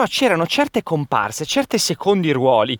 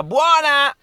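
René Ferretti impression; 11 semitones higher than usual. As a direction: shout it like a director calling the take. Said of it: "René Ferretti che ... diceva".

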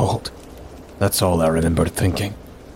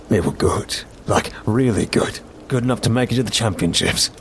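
rising, faster, building the tale; fireside storytelling; 4 semitones higher than usual. Acted. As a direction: hook the campers with the story. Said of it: "This guy had a good storytelling voice".

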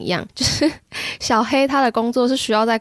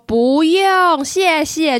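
higher and louder, clear and firm; louder reply; 6 semitones higher, 5 dB louder, much slower than usual. Shown as a demonstration. Customer answering over a noisy shop, louder. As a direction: raise your voice so the cashier finally hears. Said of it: "我通常就会用比较大声地说".

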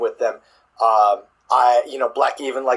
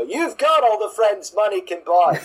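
high-pitched, hammed-up English accent; horrible English accent; 8 semitones higher than usual. Shown as a demonstration. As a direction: do a bad English manager's voice, pitched well up. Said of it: "my horrible English accent impression".

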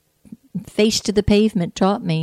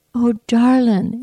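drawn-out, higher Jamaican endearment; Jamaican accent; 6 semitones higher, 5 dB louder than usual. Retold or imitated. Jamaican shopkeeper consoling a bruised customer, lightly imitated Jamaican accent. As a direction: lightly imitate a Jamaican woman, louder and slow. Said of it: "beautiful Jamaican dialect".

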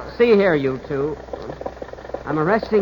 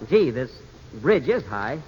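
low, softer, uneasy mumble; dizzy and uneasy; 6 semitones lower, 5 dB quieter, slower than usual. Acted. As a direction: mutter it, getting dizzy. Dizzy on the high bridge.

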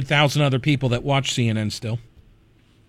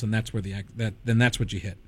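ordinary talk, nothing marked

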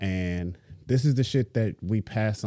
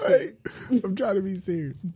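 trying not to laugh; 12 semitones higher than usual. Real, not acted. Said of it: "Was that your version of serious".

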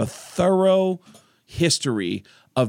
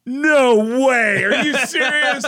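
stunned disbelief; 9 semitones higher than usual. Retold or imitated. Corey realizing the caller is a comedian, astonished laughter. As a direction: caught off guard and delighted. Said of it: "He goes".

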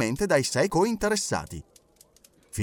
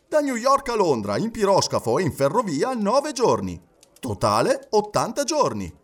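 higher-pitched, animated newspaper reading; personaggio che legge un calcolo; 8 semitones higher, 3 dB louder than usual. Acted. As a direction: read the itinerary out with lift on each leg. (lettura animata ad alta voce)

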